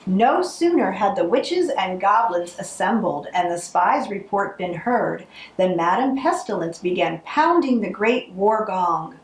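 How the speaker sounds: usual speaking voice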